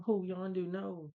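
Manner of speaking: high mock wail of grief; retold crying. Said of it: "he's crying over his body".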